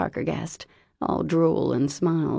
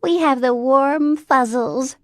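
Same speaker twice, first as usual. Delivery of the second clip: high, loud, slow gleeful crow; 10 semitones higher, 7 dB louder, slower than usual. A narrator's reading. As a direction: crow it out teasingly. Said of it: "the Holy Rollers crowed".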